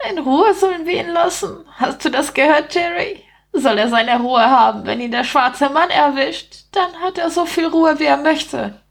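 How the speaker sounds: high-pitched rat voice, scoffing; mocking rat. Rat to Jerry about the man, high mocking voice. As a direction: scoff to a mate as a small rat.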